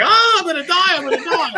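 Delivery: high, loud panicked wail; hammed-up terror of falling to death; mock panic. Rookie hanging through the floor, shrieking in mock panic. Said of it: "So I'm like".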